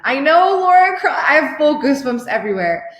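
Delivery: high, loud burst of delight; excited squeal. Real, not acted. Coach thrilled reading a client's win, squealing loudly. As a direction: squeal the name with joy.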